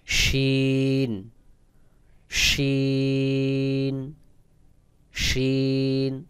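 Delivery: শিন with air rushing from inside the cheeks. Shown as a demonstration. Teacher models the Arabic letter, airy drawn-out শিন. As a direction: push breath out through a very long শিন. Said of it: "পুরো বাতাসগুলো গালের ভিতর থেকে আসবে".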